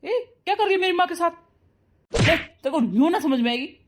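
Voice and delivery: high, quick, indignant challenge; angry confrontation. Acted. Son catches wife pushing his mother, angry and high-pitched. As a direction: burst in and challenge her angrily.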